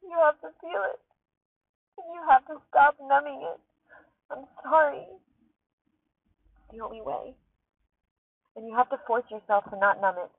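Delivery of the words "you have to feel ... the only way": crying while talking. Real, not acted. Driver crying in her car while urging listeners, tearful and breaking. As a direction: push the words out through real tears. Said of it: "And now I'm just crying".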